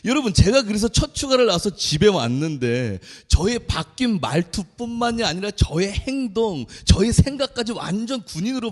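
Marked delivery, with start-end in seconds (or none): none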